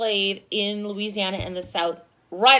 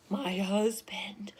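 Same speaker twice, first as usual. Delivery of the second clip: conspiratorial whisper; 4 semitones lower, 11 dB quieter, much slower than usual. Real, not acted. Teacher tells class about husband, playful whisper. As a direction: whisper it like a secret aside.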